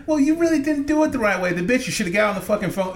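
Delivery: high, prissy mock team lead. Team lead scolding how it was fixed, mock high voice. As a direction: mimic her lecturing in a pinched high voice. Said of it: "She's like".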